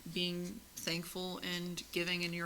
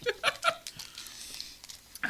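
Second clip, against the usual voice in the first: breathy laugh. Real, not acted.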